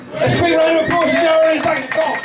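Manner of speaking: high, shouted host's call for applause; rally the crowd to clap; rousing applause call. Real, not acted. Host sending off Darren to applause, high rousing shout.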